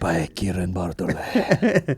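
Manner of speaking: put-on slow Spanish-accented perfume ad; slow sultry Spanish ad read; Spanish narrator voice. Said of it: "the Spanish narrator voice".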